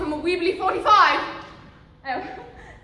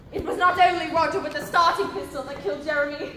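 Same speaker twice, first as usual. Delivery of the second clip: ordinary delivery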